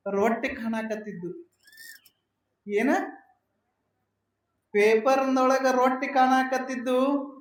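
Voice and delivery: ordinary delivery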